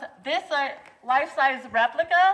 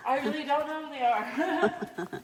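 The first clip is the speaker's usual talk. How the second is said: laughing through the words. Real, not acted. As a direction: laugh at yourself while admitting it. Admits not knowing famous people.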